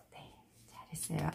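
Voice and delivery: very soft, fast murmur of praise; soft quick praise. Real, not acted. Teacher softly praising the student's reading. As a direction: murmur a quick well done.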